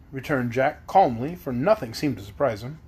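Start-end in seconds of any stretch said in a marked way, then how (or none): none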